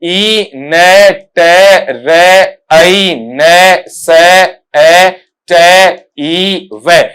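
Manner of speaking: very slow, sound by sound. Shown as a demonstration. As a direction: spell it out one sound at a time: ई ने ते रे ऐ ने से ए ते ई वे. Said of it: "इस तरीके से आएगा".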